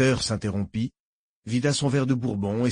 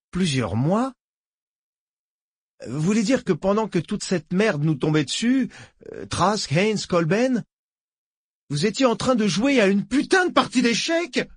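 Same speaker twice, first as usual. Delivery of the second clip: incredulous shout climbing higher; outraged disbelief; 9 semitones higher than usual. A narrator's reading. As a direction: explode at the absurdity. Harrod explodes in disbelief.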